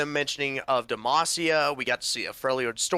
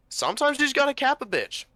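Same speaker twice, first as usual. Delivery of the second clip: mock-sassy verdict; 12 semitones higher, faster than usual. Voices a character's hardened lesson.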